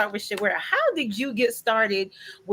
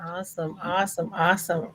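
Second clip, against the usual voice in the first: usual speaking voice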